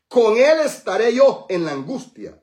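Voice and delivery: loud, high, slowed declaration; proclaiming scripture. Acted. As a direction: ring out God's promise. Preacher proclaims quoted verse.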